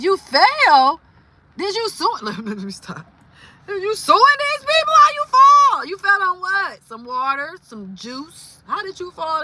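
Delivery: high, loud disbelief; shocked reaction. Real, not acted. Reacting to a viewer who fell in the store, shocked. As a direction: gasp at the news.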